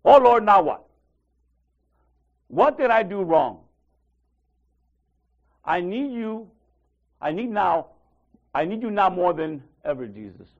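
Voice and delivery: loud, pleading cry to the Lord; hammed-up panic prayer; mock desperate plea. Preacher imitating a believer panicking before the enemy, loud plea. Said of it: "we do what they did".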